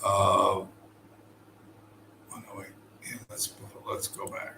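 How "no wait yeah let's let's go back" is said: hushed, breathless correction under his breath; muttered self-correction to the camera; whispered aside. Real, not acted. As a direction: whisper it to yourself like a quick retake. Stopping himself to redo the clip.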